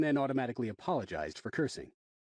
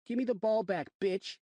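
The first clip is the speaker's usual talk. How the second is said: put-on bratty kid demand; 9 semitones higher than usual. A narrator's reading. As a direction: snarl it like a punk. Rude kid demanding ball.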